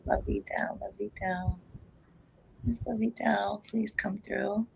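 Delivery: hushed, whispered calling; whispered summons. Real, not acted. Psychic calling the grandmother to come through, whispered. Calls the spirit under her breath.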